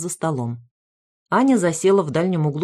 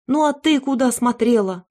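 higher accusing snap; attacking; 6 semitones higher than usual. A narrator's reading. Mother blaming her eldest daughter, accusing. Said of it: "накинулась Таня".

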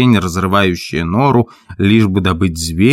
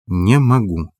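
tearful; slower than usual. A narrator's reading. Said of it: "Она заплакала и повторила".

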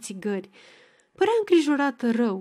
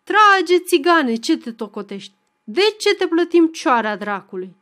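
loud, higher, bossy yell at the fiddler; commanding shout; 6 semitones higher, 7 dB louder than usual. A narrator's reading. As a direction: order him to play, shouting. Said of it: "strigă poruncitor".

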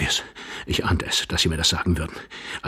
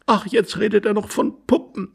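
high, despairing woman's voice; exasperated young wife; 12 semitones higher than usual. Acted. Wife hears husband babble of dolls, exasperated. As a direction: groan at his rambling.

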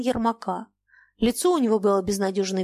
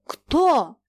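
sharp demand; 6 semitones higher, 5 dB louder than usual. Acted. Old man demands culprit.

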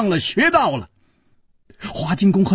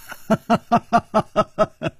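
a light, carefree hero's laugh, falling; easy laugh; 5 semitones higher than usual. Acted. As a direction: laugh it off as if unafraid. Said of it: "楚留香笑了".

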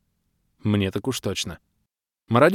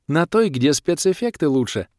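higher, smiling female character voice; cheerful; 6 semitones higher than usual. A narrator's reading. Sorceress explaining whose side she is on, cheerful. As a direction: answer with playful glee. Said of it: "радостно улыбнулась волшебница".

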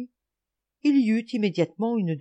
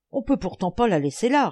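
raised, vexed objection; frustrated fuming; 5 semitones higher, 5 dB louder than usual. A narrator's reading. Lebrac unable to reach the frog, mildly fuming. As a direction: fume mildly at being thwarted. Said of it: "rageait Lebrac".

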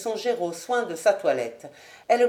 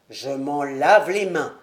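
lower, slow resignation; slow, lower weary husband; Sir William; 6 semitones lower than usual. Acted. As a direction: wash your hands of it.

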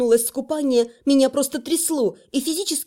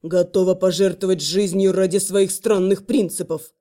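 hissed; 7 semitones lower than usual. Acted. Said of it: "змей буквально прошипел".

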